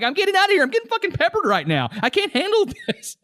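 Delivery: high-pitched, hurried comic protest with a laugh; imitated exasperated outburst. He gets up to leave, high flustered imitation. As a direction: act out his flustered walk-out, voice shooting up. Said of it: "he gets up and he's like".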